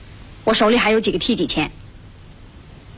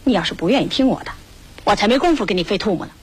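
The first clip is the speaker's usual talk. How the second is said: ordinary talk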